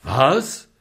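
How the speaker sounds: loud startled what; shocked apothecary; horrified. A narrator's reading. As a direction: jump back in alarm. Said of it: "fährt der Apotheker entsetzt zurück".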